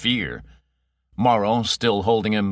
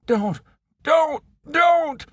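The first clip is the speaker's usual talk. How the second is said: shrieked high, louder don'ts; hysterical pleading; 4 dB louder, slower than usual. Acted. Terrified Ocken begging against torture, hysterical shriek. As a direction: scream in panic. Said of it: "close to hysteria".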